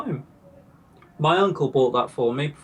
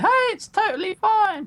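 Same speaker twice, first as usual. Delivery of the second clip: put-on high, breezy reassuring boy; cheery Akira; 4 dB louder than usual. Akira replying to the abrupt message, breezy and cheery. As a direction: brush it off brightly. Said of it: "Akira will reply and be like".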